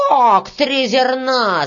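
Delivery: loud, drawn-out outraged cry; shouted protest. Acted. Red-haired worker disputes the pay, shouting. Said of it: "закричал рыжий мужичок".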